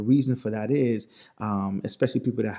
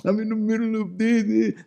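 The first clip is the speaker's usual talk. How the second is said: high-pitched whining kid imitation; protesting child; 10 semitones higher than usual. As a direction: mimic a kid pleading with Dad, much higher. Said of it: "mad at me every time".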